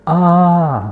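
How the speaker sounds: short high yelp of pain; pained cry. Acted. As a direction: cry out as a foot hits a stone. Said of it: "आवाज़ चिल्ला कर निकलती है".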